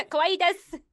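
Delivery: giggling through the phrase; laughing. Pretend. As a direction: laugh it out like an anime fan. Echoing again with laugh.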